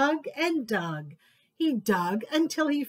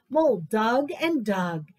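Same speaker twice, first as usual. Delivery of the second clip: ordinary delivery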